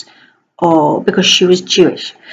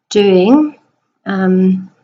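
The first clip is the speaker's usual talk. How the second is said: ordinary talk, nothing marked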